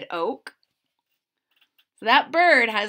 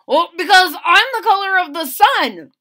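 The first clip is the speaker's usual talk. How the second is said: higher, louder boastful leaf; proud leaf; 9 semitones higher, 11 dB louder than usual. Acted. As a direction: brag to the other leaves. Yellow Hickory voiced in story.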